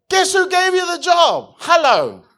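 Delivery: loud high call; shouted challenge. Real, not acted. Preacher challenges congregation, loud shout. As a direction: hammer the point home.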